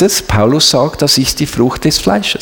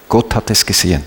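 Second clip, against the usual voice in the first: normal talking voice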